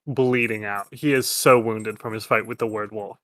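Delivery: usual speaking voice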